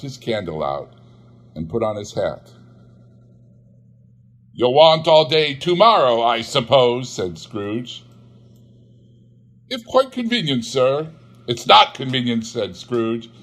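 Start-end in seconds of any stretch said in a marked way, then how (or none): 4.5-7.1 louder, sour grumble; grudging Scrooge. Acted. Scrooge grudgingly addressing his clerk, loud and sour. 9.7-11.1 much higher, meek request; timid clerk. Acted. Clerk timidly asking Scrooge for the holiday. 11.5-12.4 louder, hard refusal; curt Scrooge. Acted. Scrooge refusing his clerk, curt and loud.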